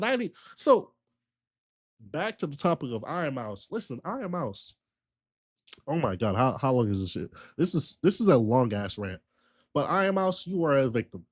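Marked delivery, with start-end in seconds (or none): none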